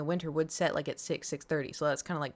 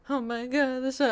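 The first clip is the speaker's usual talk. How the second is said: startled by the dark window; alarmed; 6 semitones higher than usual. Retold or imitated. Alarmed at sunset.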